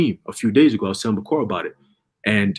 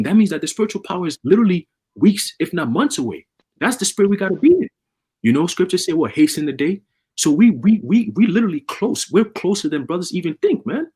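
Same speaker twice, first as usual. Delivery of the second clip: rising, louder preacher's fervor, building up; fiery preaching; 7 semitones higher, 3 dB louder than usual. Acted. Preacher firing up the brothers about coming spiritual power, higher and louder.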